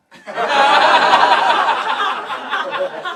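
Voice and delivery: high loud laughter burst; long wheezing laugh; big laugh. Real, not acted. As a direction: crack up mid-story. Laughs at own story.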